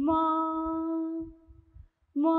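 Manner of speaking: mooing like a cow; playful cow sound. Shown as a demonstration. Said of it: "I will make a sound of an animal".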